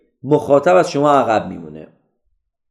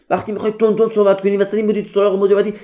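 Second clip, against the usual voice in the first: rapid-fire, higher-pitched rush; تند صحبت کردن; 7 semitones higher, much faster than usual. Shown as a demonstration. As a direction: race through it without a breath. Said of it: "هم تون صحبت کردن رو". (تند تند و پشت سر هم)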